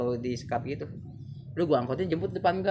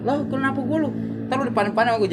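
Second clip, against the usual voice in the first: higher, louder mock threat; retold challenge; 9 semitones higher, 4 dB louder than usual. Teen daring his friend to a face-off, louder mock threat. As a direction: dare him to meet face to face, hammed up.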